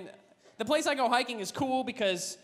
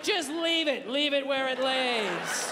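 slow, smug horse voice; cocky horse; slower than usual. Acted. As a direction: lord it over everyone. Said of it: "They're just like".

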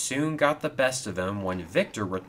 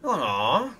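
higher-pitched disappointed moan; sad groan; 7 semitones higher than usual. A narrator's reading. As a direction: groan it like bad news just landed. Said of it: "groaned Ben sadly".

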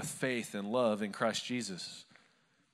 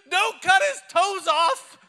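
much higher, louder, slow shrieked warning; wife screaming at the doctor's scissors; imitated scream; 12 dB louder, slower than usual. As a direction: shriek it like a panicked mother mid-birth. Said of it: "She screamed that right there".

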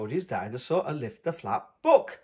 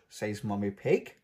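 plain reading voice

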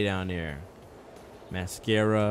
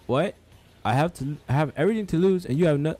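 put-on higher, louder African accent; 7 semitones higher, 5 dB louder, faster than usual. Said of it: "I can only do ... an African accent".

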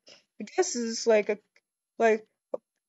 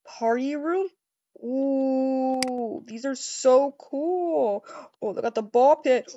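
ordinary delivery

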